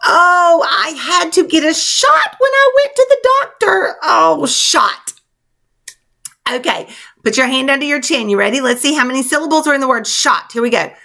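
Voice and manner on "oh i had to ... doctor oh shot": high, hammed-up wail; playful tearful complaint, pitched high; mock whining. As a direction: moan theatrically about the dreaded shot. Acts out example sentence about shot.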